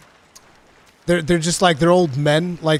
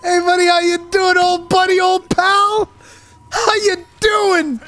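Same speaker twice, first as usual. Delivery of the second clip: much higher, louder squeaky taunt, drawn out at the end; squeaky cartoon voice; 5 dB louder than usual. Greeting the sleeping hunter in a squeaky cartoon voice. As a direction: chirp at him like a cartoon pest.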